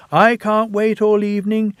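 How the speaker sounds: impatient Thomas. A narrator's reading. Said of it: "Thomas interrupted".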